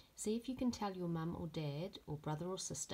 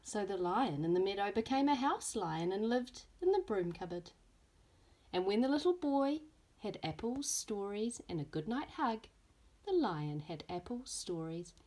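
lively storybook reading with voiced characters; reading to children; 4 semitones higher, 5 dB louder than usual. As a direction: read it like story time for little ones. Teacher reads picture book aloud.

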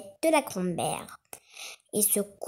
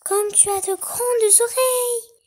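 put-on little girl voice; 12 semitones higher, 5 dB louder than usual. A narrator's reading. Girl to disguised wolf.

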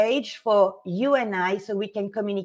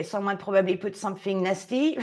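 usual speaking voice

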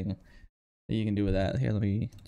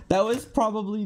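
laughing; 9 semitones higher, 4 dB louder than usual. Real, not acted. Gamer after a win, laughing and slowing. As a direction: laugh through the words.

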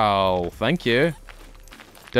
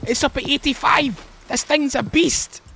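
excited; 10 semitones higher than usual. Real, not acted. Gasps at 85 pound bow.

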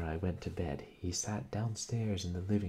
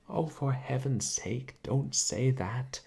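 higher, louder, slow pleading; imploring; 8 semitones higher, 6 dB louder, slower than usual. Acted. Aunt begging her nephew not to implicate Jack, slow loud imploring. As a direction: beg him not to say it, slowly. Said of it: "I implored".

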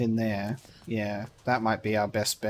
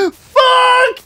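real high-pitched shriek of shock; very high, very loud startled yell; startled scream; 13 dB louder, slower than usual. Real, not acted. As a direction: scream it out in sudden panic. Startled by an in-game punch.

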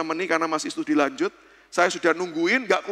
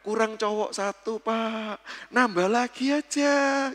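drawn-out, raised-pitch cajoling; coaxing; 4 semitones higher, slower than usual. Retold or imitated. Congregation members urging the pastor to have another child, coaxing. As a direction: nudge him along with long, stretched vowels.

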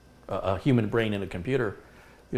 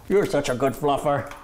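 higher and louder doting praise; excited praise; 6 semitones higher, 6 dB louder than usual. Real, not acted. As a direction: gush over the dog.

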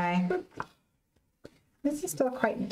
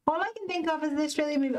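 quick Australian accent; 5 semitones higher, faster than usual. Shown as a demonstration. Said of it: "My Australian accent".

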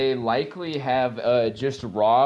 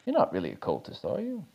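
low, soft, conspiratorial question; whispered aside; 5 semitones lower, 8 dB quieter, faster than usual. Acted. Nisby leans in and murmurs to Edgar, low and conspiratorial. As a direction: lean in and murmur it into his ear. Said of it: "he whispers in your ear".

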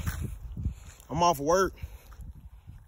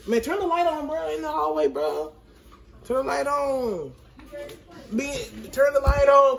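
higher calling voice down the hallway; 9 semitones higher, 5 dB louder than usual. Real, not acted. Babysitter yelling to his little cousin to turn on the hallway light, higher calling voice. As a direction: call across the house to get the light on.

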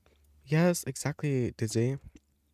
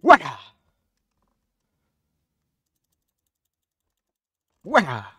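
battle cry; 4 semitones higher, 11 dB louder than usual. Pretend.

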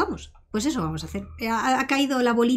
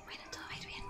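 soft unvoiced whisper; 23 dB quieter, much faster than usual. Real, not acted. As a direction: whisper it reassuringly. Reassuring after note worry.

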